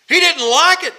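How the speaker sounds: high, loud exclamation; emphatic outburst. Real, not acted. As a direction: punch it out forcefully from the pulpit. Preacher driving home that Jesus was upset.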